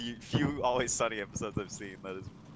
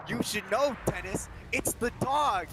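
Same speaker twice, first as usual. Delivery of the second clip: higher, slowed reveal; put-on confession voice; 8 semitones higher, slower than usual. Telling Dennis the secret ingredient, high slow reveal. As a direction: drop the news slowly in a high voice. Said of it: "it's like".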